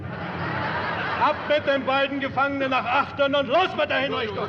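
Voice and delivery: a high, commanding shout; barked order. Acted. Captain sends the prisoners aft, a harsh command. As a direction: order the execution with relish.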